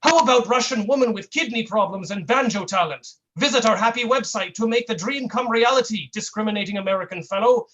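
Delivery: put-on hammy Russian-accented pitch. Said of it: "That's my Russian accent".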